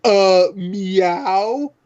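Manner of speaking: lightly imitating the original line read; first trailer meow. Joking about the trailer meow, giving a mild, hesitant rising meow. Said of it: "it's like".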